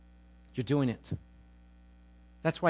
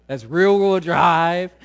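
ordinary delivery

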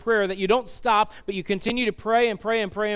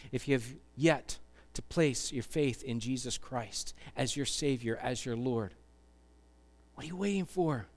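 soft, low, hushed invitation; earnest altar appeal; 4 semitones lower, 8 dB quieter than usual. Real, not acted. Preacher inviting listeners to place faith in Jesus, hushed and earnest. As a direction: appeal gently, leaving long pauses. Altar call and led prayer.

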